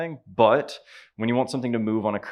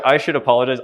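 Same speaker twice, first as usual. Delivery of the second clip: ordinary talk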